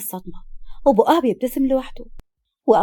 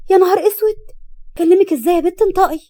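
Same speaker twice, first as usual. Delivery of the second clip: much higher and louder in alarm; shocked gasp; 7 semitones higher, 5 dB louder than usual. A narrator's reading. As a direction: blurt it out in sudden shock. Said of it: "سما شهقت بخضة". (أخت مصدومة بتصرخ بخضة)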